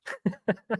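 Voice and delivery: quiet breathy chuckle; a real soft laugh. Real, not acted. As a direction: let a soft laugh slip out. Laughs after own remark.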